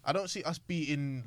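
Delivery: normal talking voice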